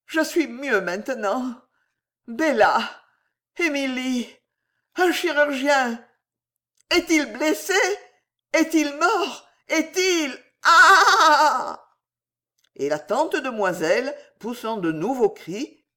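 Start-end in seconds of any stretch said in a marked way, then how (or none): 0.0-12.0 swooning aunt working up to a shriek; high anxious questions, ending in a loud cry; building hysteria. A narrator's reading. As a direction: get more frantic, then scream. Fainting aunt works up to shriek.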